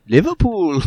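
a light Liverpool lilt on one word; mock Liverpool accent. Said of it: "thirty minutes of him going".

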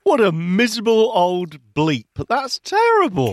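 high, indignant outburst; indignant sympathy. Real, not acted. Co-host reacts to the soaking story, indignant sympathy. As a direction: rise sharply in indignation.

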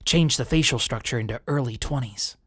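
ordinary talk, nothing marked

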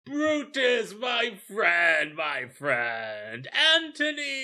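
high, shouted hailing of friends; hammed-up hearty greeting. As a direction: call out to each pal with overblown joy. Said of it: "It goes".

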